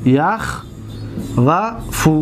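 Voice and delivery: یخوف stretched very slowly as ya-khvaf, rising at the end; teacher sounding out یخوف slowly for the class; slow drawn-out demo. Shown as a demonstration. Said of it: "اصل این طریق است".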